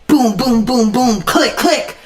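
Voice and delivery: punchy mouthed gun shots and clicks; imitated gunfire. Player imagining shooting losers in Duke Nukem, mouthed gunfire. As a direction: act out firing and reloading a gun.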